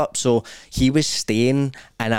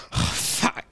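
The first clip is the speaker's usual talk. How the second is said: startled whispered swear; hushed mock-startled whisper; whispered curse; 6 dB quieter than usual. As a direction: breathe it out like you just woke up scared.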